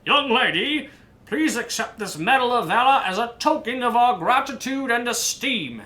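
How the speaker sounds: lightly voiced, ceremonious official; formal mayor. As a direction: present the medal with pomp. Mayor presenting medal.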